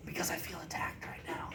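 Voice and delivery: whispered, feeling attacked; breathy whisper, half joking; worried whisper. Real, not acted. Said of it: "Why are we whispering?".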